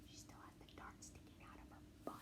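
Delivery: hushed secret whisper; telling the listener a secret; whispered aside. A narrator's reading. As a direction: whisper it so nobody hears.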